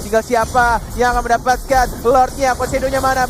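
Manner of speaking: fast, loud play-by-play hype; esports caster. Real, not acted. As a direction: call the fight like a caster. Live play-by-play of fight.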